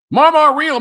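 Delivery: loud, high call-out of the name; excited shout. Real, not acted. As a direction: belt the name out like a hype greeting. Shouted greeting to a viewer.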